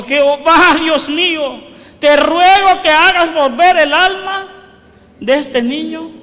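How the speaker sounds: loud, imploring prayer; clamor a Dios. Acted. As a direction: cry out to God, pleading for a child. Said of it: "y clamó a Jehová". (súplica clamada en voz fuerte)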